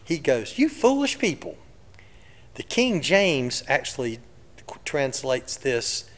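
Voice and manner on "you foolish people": high-pitched sarcastic rebuke. Retold or imitated. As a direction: scold them with a bit of sarcasm. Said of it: "with a bit of sarcasm".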